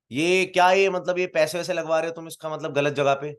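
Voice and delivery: angry father. Retold or imitated. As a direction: confront him over what he's doing to your son. Angry father confronts him.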